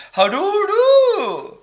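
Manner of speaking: very high, drawn-out toff "How do do"; hammed-up posh greeting. Shown as a demonstration. Showing viewers the proper British greeting, very slow posh voice. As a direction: stretch "How do do" like a pompous aristocrat. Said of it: "keep alive for our British heritage".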